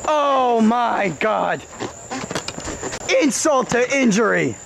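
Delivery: ordinary talk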